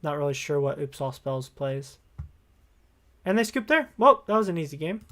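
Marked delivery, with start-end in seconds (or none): 3.2-5.1 pleased burst of surprise; upbeat, delighted win reaction; excited. Real, not acted. Opponent concedes, quick win.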